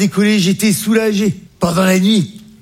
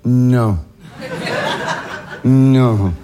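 low, drawn-out horror; dismayed disbelief; 6 semitones lower than usual. Acted. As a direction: let it sink low as dread dawns. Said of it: "je fais".

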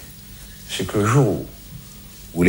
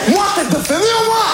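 much higher, indignant squeal; outraged disbelief; 14 semitones higher than usual. Acted. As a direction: take it as an insult, pitch shooting up. Outraged comic retort. (indignation aiguë, très haute)

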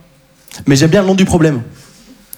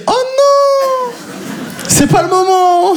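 much higher, slow panicked wail; panicked inner voice; much slower than usual. Retold or imitated. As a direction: panic inside your head. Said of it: "je me dis". (oh non aigu, panique intérieure)

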